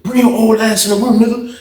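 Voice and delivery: high, insistent mock challenge; retold challenge. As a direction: act out an inmate calling someone out. Acted challenge to fight.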